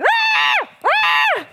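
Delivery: loud, high, shouted loon cries; loon alarm calls. Retold or imitated. Describing the loons around the eagle, loud imitated loon cries. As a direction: belt out the loons' panicked calls. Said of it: "they're going".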